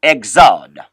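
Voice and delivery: Exode said "eg-ZOD"; low, firm Exode, second syllable falling; French pronunciation. Shown as a demonstration. Teacher shows beginners how French says it, Exode as "eg-ZOD". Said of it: "is pronounced in French".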